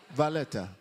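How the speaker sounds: normal talking voice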